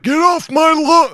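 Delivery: young guy mocking himself as a cranky old man. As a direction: play the grumpy old neighbor shouting at kids. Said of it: "I feel like I sound like".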